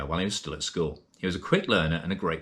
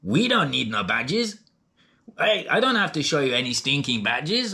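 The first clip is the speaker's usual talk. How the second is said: higher-pitched, hammed-up Mexican movie accent; big Mexican guy accent; 8 semitones higher than usual. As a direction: act out a film line in a put-on Mexican accent. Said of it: "with the big Mexican guy who says".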